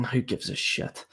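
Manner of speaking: whispered under the breath; a scornful whispered throwaway; dismissive whisper. Real, not acted. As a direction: toss it away in a hushed aside. Dismissive aside on results.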